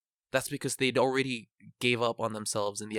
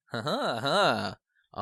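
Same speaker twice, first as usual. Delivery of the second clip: slow, drawn-out laugh, higher and louder; real laugh; 4 semitones higher, 5 dB louder, much slower than usual. Real, not acted. Speaker tying two topics together, slow raised laugh.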